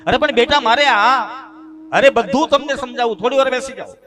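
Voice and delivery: high, loud pleading; pleading old father. Retold or imitated. Old father pleading with his son to sit. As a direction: beg him to listen.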